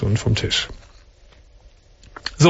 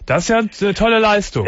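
pitched much higher to carry; speaking up; 8 semitones higher than usual. Real, not acted. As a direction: project up for the caller. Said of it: "Du musst schon ein bisschen lauter reden".

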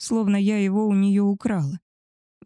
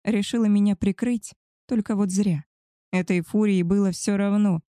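plain reading voice

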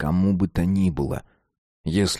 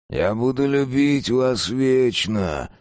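slow, drawn-out hoarse vow, slightly higher; 4 semitones higher, slower than usual. A narrator's reading. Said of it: "сказал он хриплым голосом цыгана".

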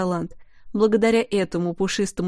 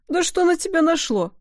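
higher-pitched, put-out grumble; grumbling; 9 semitones higher than usual. A narrator's reading. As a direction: grumble in indignant complaint. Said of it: "проворчал Бруно". (возмущённое ворчание)

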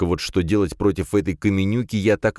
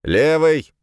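loud, higher referee call; 10 semitones higher, 6 dB louder than usual. Acted. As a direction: call the corner across the arena. Referee calls out at arena.

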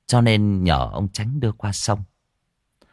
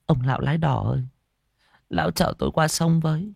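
lightly voiced young woman, pitched higher; softly spoken plea; 6 semitones higher, faster than usual. A narrator's reading. Girl asks the supposed ferryman to take her across, softly pleading. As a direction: ask the boatman meekly for a ride. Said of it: "cho nên nhỏ giọng".

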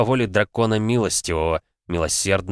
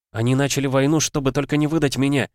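desperate outcry; 7 semitones higher than usual. Acted. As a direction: cry out in her defence. Heroine cries out.

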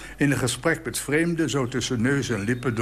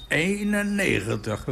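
higher, triumphant cry of the number; proud exclamation; 7 semitones higher than usual. Retold or imitated. Old man beaming at his age, calling out proudly. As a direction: announce it with pride. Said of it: "riep hij uit".

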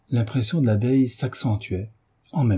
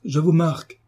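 high, indignant exclamation; angry outcry; 8 semitones higher than usual. A narrator's reading. Surveillante marking down the cheeky child, angry outcry. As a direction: exclaim it as a threat, pitched high. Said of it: "s'écria mademoiselle Pélagie".

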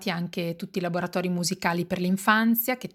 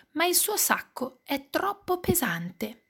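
usual speaking voice

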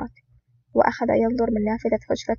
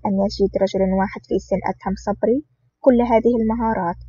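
a louder, bold announcer read of the title and blurb; title announcement; 3 dB louder than usual. Acted. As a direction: introduce the book like a trailer voice. (إعلان العنوان بصوت مذيع قوي)

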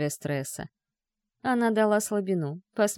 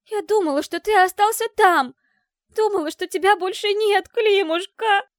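hysterical shouting; 11 semitones higher, 6 dB louder than usual. Acted. Dasha hysterical, relieved he's alive.

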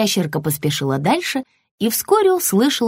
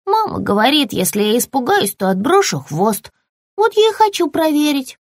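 high, bright little-creature voice, curious; a tiny lizard keen to test something; small lizard, eager; 7 semitones higher than usual. Acted. Lizard figure held voice.

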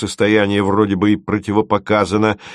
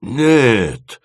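higher, drawn-out wail of protest; crying out; 4 semitones higher than usual. A narrator's reading. Dima protests to Timofeev, crying out. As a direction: wail the word out. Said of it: "возопил Дима".

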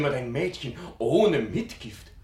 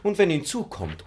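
usual speaking voice

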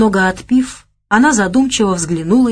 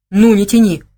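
irritated; 4 semitones higher, 5 dB louder than usual. A narrator's reading. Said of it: "Он начал злиться".

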